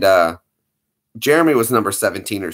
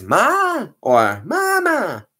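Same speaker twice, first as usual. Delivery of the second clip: slow sung-out ma and mama; cat calling mama; imitating the cat; 12 semitones higher, much slower than usual. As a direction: draw out mama like a meow. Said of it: "That's how she calls Evie".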